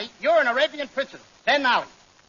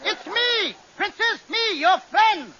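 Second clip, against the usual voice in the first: calling into tent; 7 semitones higher than usual. Acted. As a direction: shout into the tent to the princess.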